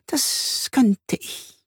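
slow, high, whispery spider; high whispered, teasingly slow reply; spider character. A narrator's reading. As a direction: draw it out in a wispy voice. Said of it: "erwidert Sibylle".

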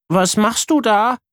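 small brother peeking in; little brother. Acted. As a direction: ask like a nosy little boy. Brother Luca's voice.

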